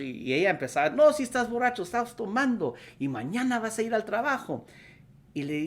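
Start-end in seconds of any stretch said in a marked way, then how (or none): 0.9-4.6 high-pitched retold nagging; scolding wife. Wife arguing with her drunk husband, acted scolding. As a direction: act out an indignant scolding, pitched well up.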